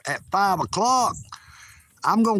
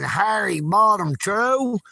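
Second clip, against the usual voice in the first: usual speaking voice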